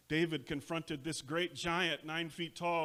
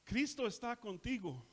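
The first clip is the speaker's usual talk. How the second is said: normal talking voice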